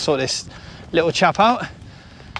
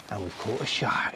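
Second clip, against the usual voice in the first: whispering; 7 semitones lower, 11 dB quieter than usual. Real, not acted. Joking aside to camera.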